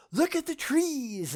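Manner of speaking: lightly mocking, slow and lifted in pitch; mocking quote. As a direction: parody a wide-eyed believer, slowly. Mocking what pathetic believers say.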